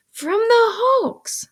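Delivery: high, slow, emphatic reply; cross answer. A narrator's reading. Sister answers the questioning boy, cross. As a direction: spell it out with irritation.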